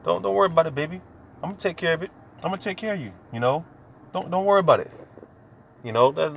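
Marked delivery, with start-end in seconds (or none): 0.0-4.9 higher, gentle comforting voice; husband comforting his wife, tender and high; tender reassurance. Retold or imitated. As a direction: soothe her like a husband. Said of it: "how Jake talked to their rib".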